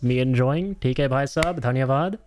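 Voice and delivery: a hint of an Indian accent, playful thanks; Indian accent on request. Streamer doing an Indian accent for chat, lightly. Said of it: "Indian accent more, please".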